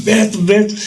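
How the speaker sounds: high, excited call of the brother's name; happy calling out. Retold or imitated. Boy telling his brother the news, thrilled call. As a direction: call your brother full of joy. Todo felizão.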